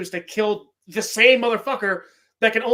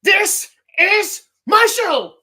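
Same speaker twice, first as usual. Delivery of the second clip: much higher, louder, slow villain voice; Cobra Commander voice; 10 semitones higher, 8 dB louder, slower than usual. Retold or imitated. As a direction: drag it out like a cartoon villain. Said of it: "I'm the Cobra Commander up in this bitch".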